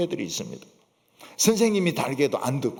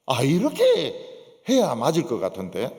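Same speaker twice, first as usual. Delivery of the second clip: usual speaking voice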